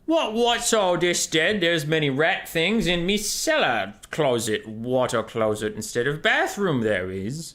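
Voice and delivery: high, slow hammed-up British accent. Shown as a demonstration. Asked by chat for a British accent, slow hammed-up British voice. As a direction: ham up a Cockney bobby. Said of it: "Say something in a British accent".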